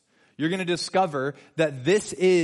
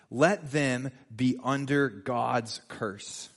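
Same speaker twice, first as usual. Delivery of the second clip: slow solemn read; 3 semitones lower, much slower than usual. Shown as a demonstration. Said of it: "read slowly, emphatic".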